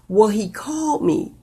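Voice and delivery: ordinary talk, nothing marked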